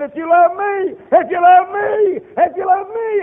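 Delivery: high, loud repeated plea; Jesus pleading. Retold or imitated. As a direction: cry it out again and again. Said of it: "He said".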